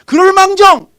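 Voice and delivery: preaching at full force. Acted. Pastor exhorting fathers, loud and high. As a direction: burst out to drive the point home.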